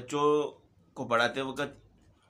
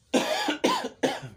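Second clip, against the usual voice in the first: a real cough mid-recitation. Real, not acted. Teacher coughs during recitation, then apologises. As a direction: clear the throat. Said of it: "معذرت چاہتا ہوں".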